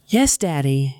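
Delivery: a hint of pride, lightly voiced small boy; proud little boy. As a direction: answer like a toddler pleased with himself. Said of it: "with a hint of pride in his voice".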